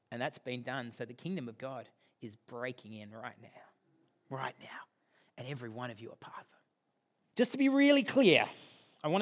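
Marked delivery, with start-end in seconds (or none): 4.3-4.8 soft whisper of the words; whispered echo. Real, not acted. Preacher whispering the phrase back to the church. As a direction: breathe it back to them.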